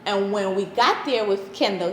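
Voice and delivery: ordinary talk, nothing marked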